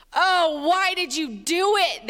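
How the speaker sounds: loud, drawn-out narrated groan. A narrator's reading. Sailors to runaway Jonah, groaning in dismay. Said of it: "they groaned".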